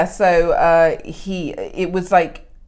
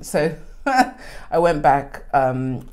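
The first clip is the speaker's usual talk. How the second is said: ordinary talk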